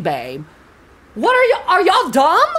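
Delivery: ordinary delivery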